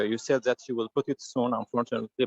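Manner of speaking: normal talking voice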